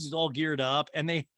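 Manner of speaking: ordinary delivery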